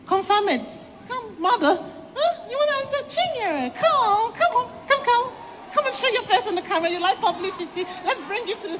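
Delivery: high taunting call; mocking. Real, not acted. Woman mocks pastor, calls him out.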